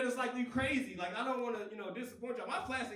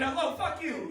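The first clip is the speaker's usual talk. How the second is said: mock broken English; 5 semitones higher, 7 dB louder than usual. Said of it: "They said".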